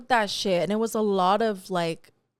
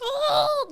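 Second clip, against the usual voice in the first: high-pitched drawn-out "oh!"; mock frantic cry; 3 dB louder than usual. Telling how the workout went beast mode, mock frantic cry. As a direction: cry out high, acting out the frenzy. Acting out going hard at workout.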